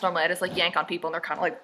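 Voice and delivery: ordinary talk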